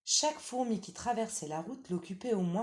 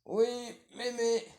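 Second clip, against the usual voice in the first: put-on wolf putting on a slightly higher little-girl voice; wolf pretending to be the granddaughter; wolf faking girl; 7 semitones higher, 5 dB louder than usual. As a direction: coax like a sly wolf playing innocent.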